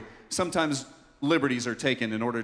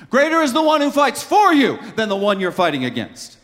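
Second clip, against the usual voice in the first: high, loud, driving sermon climax; full-force preaching; 10 semitones higher, 7 dB louder than usual. Real, not acted. Pastor answering how Jesus can say that, full-force preaching. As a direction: raise the voice and preach it fervently.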